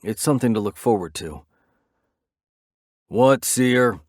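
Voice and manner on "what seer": louder, curt snap at the caller; snapping. Acted. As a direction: bite off What, Seer, irritated. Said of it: "Reaper snaps".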